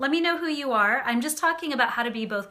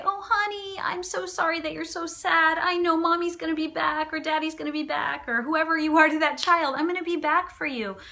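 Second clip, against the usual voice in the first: higher, syrupy, pleading comfort; overly soft parent; 6 semitones higher than usual. Shown as a demonstration. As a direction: gush over the crying toddler, unable to let go. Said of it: "or you could say".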